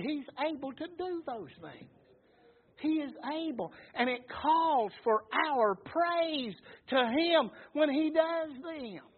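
high, drawn-out praise from the pulpit; exultant preaching. Acted. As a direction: start on a shout, then stretch the praise out. Preaches praise after story climax.

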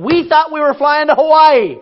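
mock-indignant preaching rant; comic rant. Real, not acted. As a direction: pile up the gripes with mock outrage. Comic complaint list, raised voice.

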